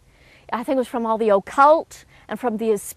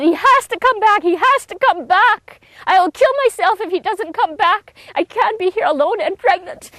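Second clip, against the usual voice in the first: reliving the panic, begging through tears; desperate crying plea; 7 semitones higher than usual. Retold or imitated. Pregnant woman begging friends by her bed, desperate and tearful. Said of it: "I just cried and screamed".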